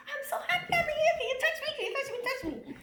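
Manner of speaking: high, loud, begging to be touched; pleading with the spirit face, excited and high; excited plea. Retold or imitated. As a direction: call out eagerly to the face.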